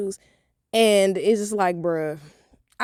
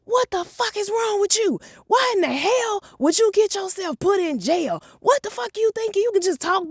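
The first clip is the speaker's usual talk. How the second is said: high, shrill-free mock angry mom voice, pitched way up; mom going off; 12 semitones higher than usual. Mom chewing out her daughter after jail, high mock yell. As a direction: play the furious mom yelling at her kid. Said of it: "she just started going off".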